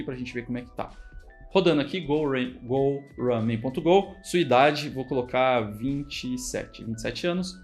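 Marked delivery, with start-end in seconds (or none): none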